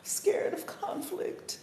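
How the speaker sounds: whispered, high and slow; mock whisper. Teasing those who dodge conflict, whispered mock. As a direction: hiss it like a mocking secret.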